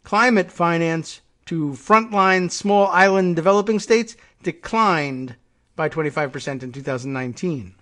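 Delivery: reading a leader's speech aloud with a measured, declaiming tone; formal speech read. Acted. Reading the prime minister's climate speech to viewers, formal oratory. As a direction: deliver it like an address to world leaders.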